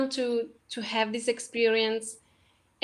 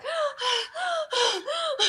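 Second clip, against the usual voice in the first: high, sudden gasp of overwhelm; sharp gasp; 13 semitones higher, 3 dB quieter than usual. Retold or imitated. Overwhelmed by gratitude, a high gasp. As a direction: gasp as if losing breath. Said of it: "hyperventilating almost".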